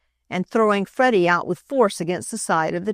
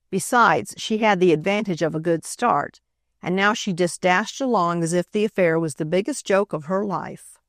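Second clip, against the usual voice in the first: ordinary delivery, nothing marked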